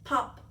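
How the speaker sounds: sudden loud pop. A narrator's reading. Narrator voicing a head springing from snow, popping. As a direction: burst the word out. Sound effect of head emerging.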